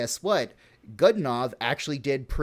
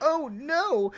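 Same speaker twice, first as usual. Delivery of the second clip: mock whiny aristocrat; 12 semitones higher than usual.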